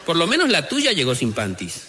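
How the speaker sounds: high, laughing husband; the other husband. Acted. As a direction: top his buddy's story, laughing. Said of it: "uno le dice al otro". (marido riendo con voz aguda)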